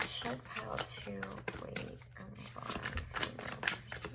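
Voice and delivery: hushed whisper to the cards. Acted. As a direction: breathe the question as a secret.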